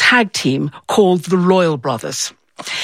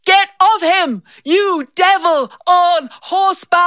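crying out; 10 semitones higher than usual. Retold or imitated. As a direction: hurl the insult with all your heart. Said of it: "I cried out".

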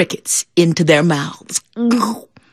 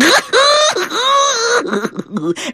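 high laugh. Real, not acted. Storyteller laughs.

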